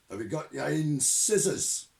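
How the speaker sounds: loud, high and irritated; old sergeant barking down the phone at the recruit; impatient sergeant. Acted. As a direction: bark it, exasperated. Shadwell impatient.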